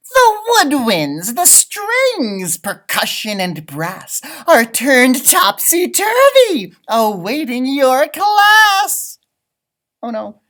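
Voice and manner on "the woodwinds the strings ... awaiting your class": louder, high, slow announcement; dramatic reading. Acted. Teacher reading the leprechaun's note aloud, dramatic. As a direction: proclaim it like a riddle.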